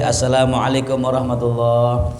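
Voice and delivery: low, even, intoned closing greeting; imitated imam's salam. Imam closing the prayer before shifting, low intoned salam. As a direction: intone it steadily as if ending prayer. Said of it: "kadang ada imam yang bijak".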